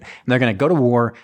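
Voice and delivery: normal talking voice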